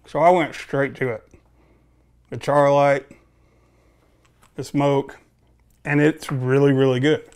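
talking around pipe stem; pipe in mouth. Real, not acted. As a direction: lips clenched on pipe. Seen 0: Pipe stem held between lips through most words, briefly removed near 5s.

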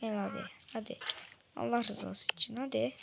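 soft, begging murmur; pleading under breath. Real, not acted. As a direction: beg the game quietly. Oyunda yalvarıyor. (sessizce yalvararak)

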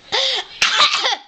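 forced fake cough. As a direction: cough on purpose. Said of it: "that was a fake cough".

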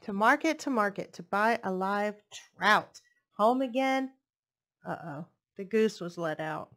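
put-on lilting read-aloud voice for little kids; storybook reading. Reading a picture book to young children, lilting storytelling. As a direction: read it like bedtime story time.

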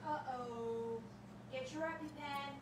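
ordinary talk

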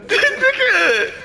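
high laughing cry. Real, not acted. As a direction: laugh the word out. Laughing exclamation.

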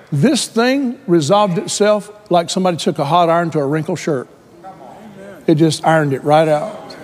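usual speaking voice

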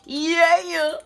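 a high, loud, crooned "yeah" like an R&B singer. Retold or imitated. Throwing in a singer's "yeah" while hyping up the divine feminine, crooned high and loud. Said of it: "In my [name] voice".

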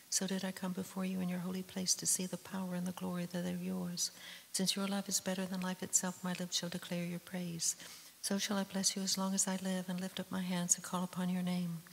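normal talking voice

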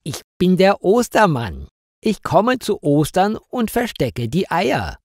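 high-pitched Easter man voice; odd stranger introducing himself, voice lifted; costumed stranger. A narrator's reading. Costumed stranger explains who he is.

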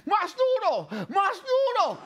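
high mock drill yell; imitated yelled orders. Tete passes on the trainer's orders, imitated yelling. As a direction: bark it like a demanding trainer. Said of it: "me las grita a mí".

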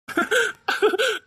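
high delighted laugh. Acted. Family celebrates new baby.

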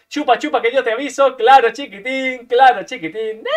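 loud, high cocky taunt; smug teasing; gloating. Real, not acted. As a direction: rub it in, laughing. Gloating at enemy.

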